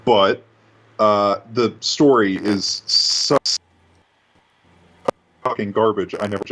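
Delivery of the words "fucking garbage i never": ordinary talk, nothing marked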